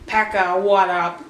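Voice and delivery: low, cool-guy greeting; mock casual cool. Fan pretends to greet the goalie casually, mock cool. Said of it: "Really didn't say that".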